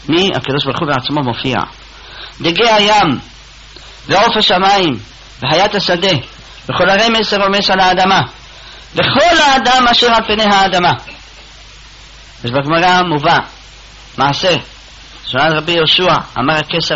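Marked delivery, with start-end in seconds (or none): 2.4-10.9 preaching verse. Acted. Proclaiming verse at full force.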